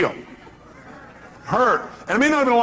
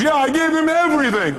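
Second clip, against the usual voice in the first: distraught caller; 11 semitones higher, 3 dB louder than usual. Retold or imitated. Caller lamenting her breakup to the host, mock wail. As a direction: imitate an upset woman, pitched way up.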